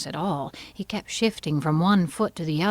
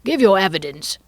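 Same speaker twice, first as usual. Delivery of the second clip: angry; 3 semitones higher, 7 dB louder than usual. A narrator's reading. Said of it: "the king repeated angrily".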